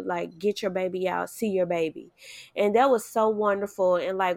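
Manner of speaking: usual speaking voice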